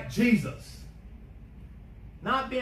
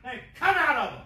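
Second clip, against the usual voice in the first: loud, higher rebuke hurled at a demon; furious command; 8 semitones higher, 8 dB louder than usual. Pretend. Preacher rebuking a demon to shut up and leave, a loud commanding shout. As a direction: seize it and roar it out. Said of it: "so mad ... take that demon by his throat and say".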